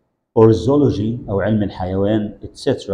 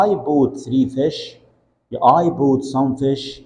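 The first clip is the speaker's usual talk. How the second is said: slow model sentences; 2 semitones higher, much slower than usual. Shown as a demonstration. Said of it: "teacher models slowly".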